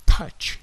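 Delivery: touch with an exploding t; loud popped t in touch; plosive t. Shown as a demonstration. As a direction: burst the t of touch like a small explosion. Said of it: "T itu plosive, meledak".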